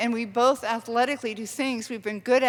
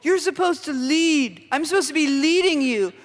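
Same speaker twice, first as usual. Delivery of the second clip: higher, louder mock lecture of a bossy partner; mock bossy husband; 5 semitones higher, 4 dB louder than usual. Quoting her partner's bossy line, mock macho tone. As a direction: parrot his macho line back at him. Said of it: "Or else he'd say".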